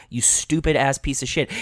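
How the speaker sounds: ordinary talk, nothing marked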